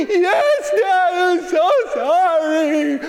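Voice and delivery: high, slow mock wail; hammed-up tearful pleading; crying apology. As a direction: imitate a little boy bawling sorry. Crying son apologizes to Dad.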